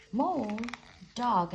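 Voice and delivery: usual speaking voice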